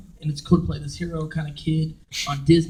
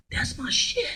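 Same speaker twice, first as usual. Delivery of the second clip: breathless thrill; 8 dB quieter, slower than usual. Retold or imitated. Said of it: "He goes".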